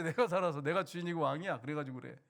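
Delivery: usual speaking voice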